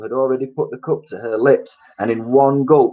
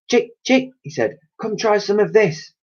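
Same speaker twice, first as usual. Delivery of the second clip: high coaxing call to a hen; 11 semitones higher than usual. A narrator's reading. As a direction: coax the chicken over. George calling hen.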